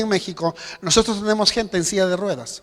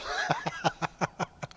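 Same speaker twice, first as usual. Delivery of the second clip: breathy laugh; 4 semitones lower, 11 dB quieter than usual. Pretend. Laugh after mock delight.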